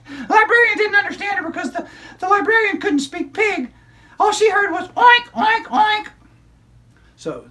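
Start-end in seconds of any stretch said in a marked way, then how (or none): 0.1-6.1 put-on high, bright child puppet storyteller; squeaky kid character excitedly telling a story; high-pitched puppet girl narrating. Puppet continues.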